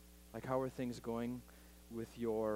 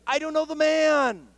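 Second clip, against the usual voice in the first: much higher, loud desperate cry; panicked denial; 15 dB louder than usual. Retold or imitated. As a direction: blurt it out in a panic, high and loud. Peter's final panicked denial.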